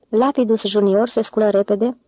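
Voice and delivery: plain reading voice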